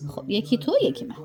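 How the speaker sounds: normal talking voice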